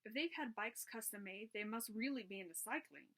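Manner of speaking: usual speaking voice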